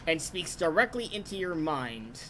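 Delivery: normal talking voice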